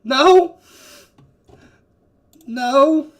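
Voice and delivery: high, loud in-character no; shouted denial. Acted. Man outside denying anyone is there, high loud shout.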